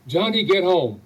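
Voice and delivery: loud, stern command to come home; this time you mean it; firm shout. Retold or imitated. Mother finally ordering her son home, stern shout.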